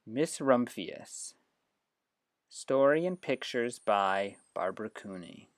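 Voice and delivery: clear, measured picture-book reading; gentle read-aloud for a class. Shown as a demonstration. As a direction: read it like story time for children. Reads picture book aloud to app.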